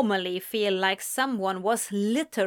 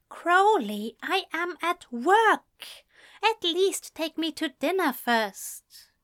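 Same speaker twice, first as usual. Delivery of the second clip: higher, flustered mock grumble; grumbling; 8 semitones higher than usual. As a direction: grumble in embarrassed protest. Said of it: "Aziraphale grumbled".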